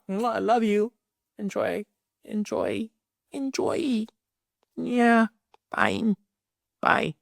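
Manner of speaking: high sugary enjoy; sugary goodbye. Acted. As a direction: wave them off in a sing-song. Mock sweet send-off.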